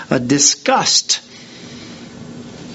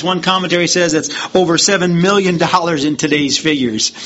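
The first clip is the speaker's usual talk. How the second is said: usual speaking voice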